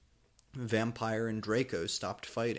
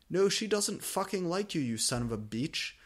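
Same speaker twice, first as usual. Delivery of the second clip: high yell; 6 semitones higher than usual. A narrator's reading. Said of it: "yelled Draco".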